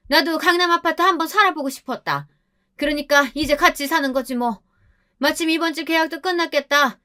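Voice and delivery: high-pitched, brazen mother-in-law voice; mock yell, pitched high without extra volume. A narrator's reading. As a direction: confront the couple in a put-on high voice. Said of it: "방문을 쾅쾅 두들기며 소리를 질렀습니다".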